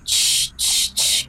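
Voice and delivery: retold hushing "ch, ch", breathy and unvoiced; imitated demonic shush; possessed shushing. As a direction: shush them hard under your breath, like something inhuman. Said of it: "nos empezaba a decir".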